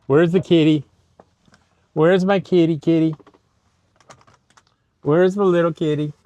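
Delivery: high, loud calling the kitty. Real, not acted. Calling for the cat.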